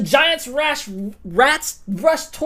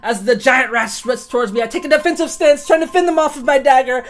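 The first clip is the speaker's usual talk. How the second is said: ordinary talk, nothing marked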